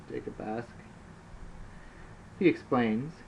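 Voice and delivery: ordinary talk, nothing marked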